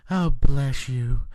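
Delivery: slow, drawn-out sanctimonious "Oh, bless you"; mock pious blessing. Mocking church people who nearly hit his family, sanctimonious drawl. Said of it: "they try to do that fucking bullshit where they're like".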